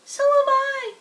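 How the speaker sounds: high, loud little squeak; a second tiny spider pipes up. A narrator's reading. Another baby spider chimes in, high and loud. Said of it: "said another tiny voice".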